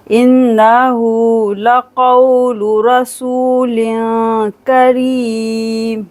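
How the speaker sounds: very slow, drawn-out tajweed chant; Quranic recitation. Shown as a demonstration. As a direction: recite it slowly, Quran style. (धीमी तजवीद तिलावत)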